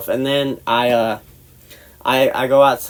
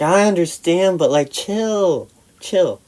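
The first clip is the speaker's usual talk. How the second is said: exasperated placating; 6 semitones higher than usual. Retold or imitated. Retold attempt to calm cousin.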